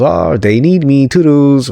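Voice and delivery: lightly sending up a cocky exit; mocking Ricky. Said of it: "'cause he goes".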